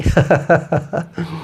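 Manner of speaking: short, soft chuckle; quick giggle between phrases; laughing. Real, not acted. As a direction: break into a brief run of ha-ha laughter. Laughs after mentioning pig issue.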